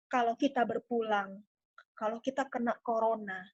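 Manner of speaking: tactful, hushed sales talk. Shown as a demonstration. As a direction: broach it gently and low. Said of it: "intonasi yang lebih rendah, kemudian lebih pelan".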